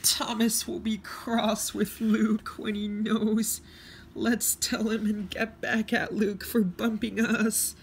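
very high, squeaky cartoon voice; cheeky railway cars. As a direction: put on a tiny high voice for mischievous cars.

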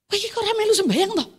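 sharp, fast scolding in Javanese, rising to loud; a husband's sudden irritated rebuke. Retold or imitated. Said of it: "Loh, langsung gitu".

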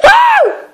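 sudden high scream; startled scream. Real, not acted. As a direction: scream at the jump scare. Jump scare in horror game.

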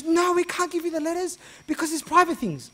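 shrill-high, loud refusal; agitated wife. Retold or imitated. Agitated wife blurting out refusal, very high and loud. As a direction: protest in a panic.

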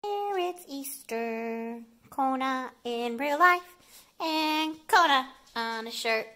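normal talking voice

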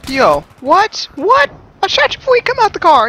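high, loud startled outburst; surprised shout. Real, not acted. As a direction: yelp in shock, then rant fast. Startled by sudden in-game kill.

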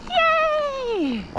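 high-pitched gleeful yay; real joyful whoop; excited cheer. Real, not acted. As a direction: squeal it out with delight. Thrilled to be climbing again.

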